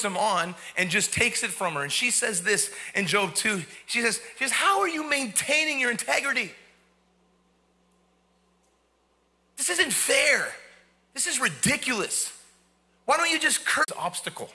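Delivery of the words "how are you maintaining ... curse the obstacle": high, exasperated wife's complaint, only mildly heated; a touch of the wife's upset outburst; mock angry wife. Job's upset wife scolding him, mild mock anger. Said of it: "now she's just pissed".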